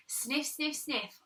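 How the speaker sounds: ordinary delivery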